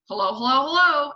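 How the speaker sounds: high, loud parrot call; loud and clear. A narrator's reading. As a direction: rise to a loud squawked greeting. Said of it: "a voice came loud and clear".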